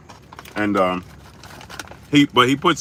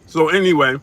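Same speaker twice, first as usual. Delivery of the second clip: loud; 5 semitones higher, 5 dB louder than usual. Real, not acted. Loud topic change.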